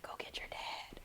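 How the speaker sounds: hushed whisper, no voice; whispered order. Retold or imitated. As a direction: hiss the order under breath. Whispered order, then yelled.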